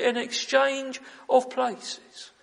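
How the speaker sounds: drawn out slowly, each word weighed; slow emphasis. Acted. Preacher quoting Hugh Martin, slowly weighed. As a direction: let every word land, slowly.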